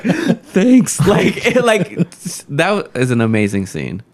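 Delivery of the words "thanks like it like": high, tearful squeak with a laugh in it; mock tearful. Tearful customer thanking the server for breakfast, mock-tearful squeak. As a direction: play at choking up, pitched way up. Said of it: "I'm, like, tearing up".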